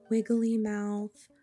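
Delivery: ordinary talk, nothing marked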